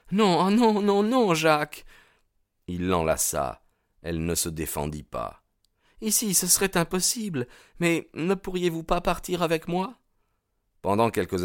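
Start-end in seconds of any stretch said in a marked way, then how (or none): 0.1-1.9 put-on high young woman's plea; Etty, protesting. A narrator's reading. Young woman refuses to stay here, high pleading voice. 6.0-10.0 put-on high-pitched young woman; Etty, pleading. A narrator's reading. Young woman asks suitor to leave with her, high pleading voice.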